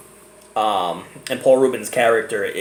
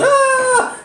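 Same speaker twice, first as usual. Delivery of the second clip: high shrill-free movie scream, much higher and louder; hammed-up slasher scream; imitated scream; 4 dB louder than usual. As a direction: mimic a horror-film victim shrieking. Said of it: "looks in the mirror, and goes".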